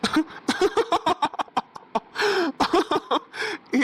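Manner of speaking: high, drawn-out villain cackle; evil laugh. Acted. Villain laughing at the begging woman, a high evil cackle. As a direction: laugh at her pain like a gloating villain.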